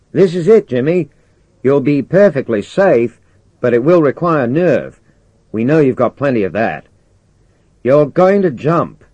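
loud, high, deliberate radio delivery; commander giving orders. Acted. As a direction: break the plan to him firmly, projecting. Commander gives Jimmy the plan.

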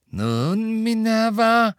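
drawn-out spite, rising high and loud; spiteful. A narrator's reading. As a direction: gloat at a colleague, swelling on the name. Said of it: "sagte Fudge gehässig".

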